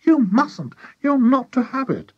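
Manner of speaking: high, loud, urgent forbidding; high-pitched frantic objection; agitated protest. A narrator's reading. Character forbids the drinking.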